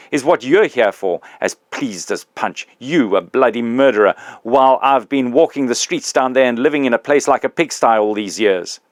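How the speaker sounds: put-on the big ghost confronting his old employee, loud and aggrieved; indignant big ghost.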